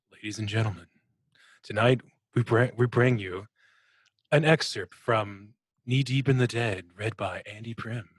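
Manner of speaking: measured, stately radio announcer intro; classic radio announcer. Shown as a demonstration. Cohost asked for it, opening the reading like a radio announcer. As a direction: introduce the broadcast like an old-time radio host. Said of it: "a classic radio announcer intro".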